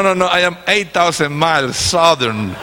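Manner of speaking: ordinary delivery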